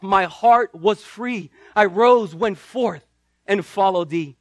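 slow, swelling recital of a hymn verse; hymn recitation. Acted. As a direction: build each line toward triumph.

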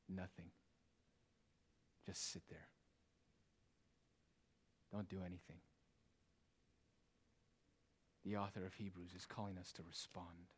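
very soft and low, long pauses; hushed preaching. Real, not acted. Preacher tells congregation what leads to hell, hushed preaching. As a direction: drop right down and let each phrase hang. Quiet answer to own question.

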